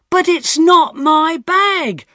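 high, loud, slow protest; shouted. Acted. Tom shouting his innocence at the police, loud. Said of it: "I shouted".

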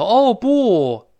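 high, loud, overdone stilted wail; hammed-up translationese exclamation; mock dubbing-style exclamation. Teacher says Chinese people don't talk like this, hammed-up stilted exclamation. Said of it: "这个别扭啊".

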